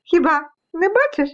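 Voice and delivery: high, cheeky little voices; high-pitched storybook taunt; teasing dust specks. Acted. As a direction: goad the seeker from hiding. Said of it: "дражнилися пилинки".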